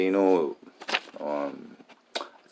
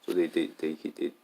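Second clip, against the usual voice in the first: ordinary talk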